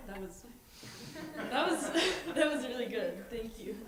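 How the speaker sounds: laughing through tears; a teary laugh of thanks; tearful grieving thanks. Real, not acted. Tearful thanks after eulogy.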